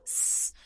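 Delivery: a long voiceless s hiss, very soft, like air leaking from a tire. Shown as a demonstration. Therapist showing a child the deflating tire sound, soft voiceless hiss. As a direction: let the s leak out slowly like a flat tire. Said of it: "el sonido de la llanta desinflándose".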